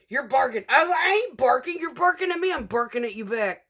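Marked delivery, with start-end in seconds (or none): 0.1-0.6 imitating coworker. Coworker's reply in quarrel. 1.0-3.6 high, loud heated comeback; retold argument. Retold quarrel with coworker.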